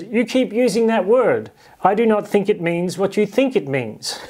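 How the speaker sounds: movie quote. Retold or imitated. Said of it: "where the character says".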